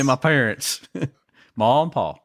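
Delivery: put-on a touch of a Southern hillbilly accent; half-joking hint of a Southern hillbilly accent; weird Southern accent. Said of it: "I'm using a weird accent right now".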